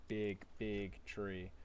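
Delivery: ordinary talk, nothing marked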